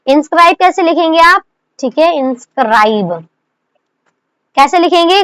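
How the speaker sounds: ordinary talk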